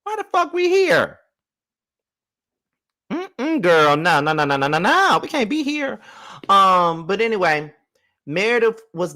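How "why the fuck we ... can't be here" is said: squealing high no, no, no; high mock refusal. Mock refusal to stay there.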